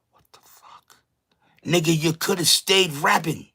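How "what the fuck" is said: whispered in disbelief; a real, hushed what-the-fuck; stunned whisper. Real, not acted. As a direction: breathe it out, no voice. Stunned reaction to the clip.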